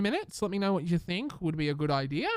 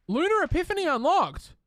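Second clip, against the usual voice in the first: announcer read; 11 semitones higher, 4 dB louder than usual. Pretend. Reading game unlock announcement.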